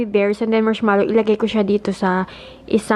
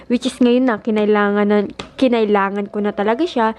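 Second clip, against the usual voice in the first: ordinary delivery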